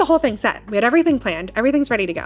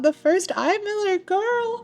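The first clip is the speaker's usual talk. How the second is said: high, slow, delighted; excited; 12 semitones higher, slower than usual. Retold or imitated. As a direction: gush with delight. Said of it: "And I'm like".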